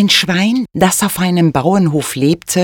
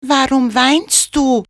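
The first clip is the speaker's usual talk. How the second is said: donkey voice; 9 semitones higher than usual. A narrator's reading. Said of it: "fragte er das Schwein".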